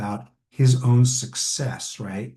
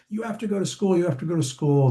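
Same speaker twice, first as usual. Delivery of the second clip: retold yelling; 9 semitones higher, faster than usual. Father pestering his son about school, mock yelling. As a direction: nag insistently in a raised pitch, imitating a scolding. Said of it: "Yell at him, yell at him".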